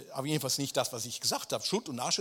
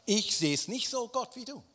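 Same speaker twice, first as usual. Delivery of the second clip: cheeky defiance; 9 semitones higher, 4 dB louder than usual. Acted. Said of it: "diese Frechheit zu sagen".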